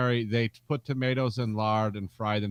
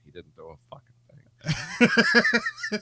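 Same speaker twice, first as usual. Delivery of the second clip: grumpy Michigan engineer; 10 semitones higher, slower than usual. Retold or imitated. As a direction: drag the complaint out like a bitter coworker. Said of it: "the guy from Michigan's like".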